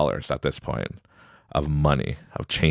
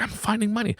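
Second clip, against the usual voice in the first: high-pitched kid imitation; excited child; 3 dB quieter than usual. Son returns from park with change, high excited imitation. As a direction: retell it in a high, thrilled kid voice.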